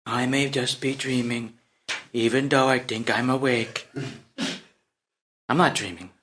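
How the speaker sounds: low, soft wondering to himself; hushed musing. Acted. Prince ponders whether he may be dreaming, hushed musing. Prince muses on the king's warning.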